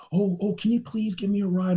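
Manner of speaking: high-pitched mock pleading; pleading woman.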